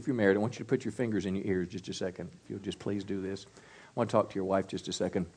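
hushed, fast instruction to the husbands; quiet aside. Real, not acted. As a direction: drop the voice, fast and confiding.

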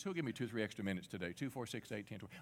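rapid auction-style count; auctioneer. Pretend. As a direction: rattle off bids like an auctioneer. Asking crowd for extra minutes.

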